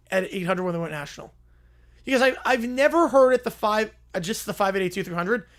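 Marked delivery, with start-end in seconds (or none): none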